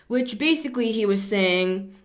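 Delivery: ordinary talk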